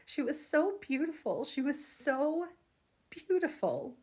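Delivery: holding back real tears; voice breaking with emotion. Real, not acted. Describing her dream baby to viewers, voice breaking. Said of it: "oh, I'm gonna cry".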